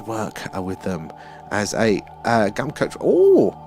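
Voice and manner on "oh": surprised. Real, not acted. Reacts to chatter's job.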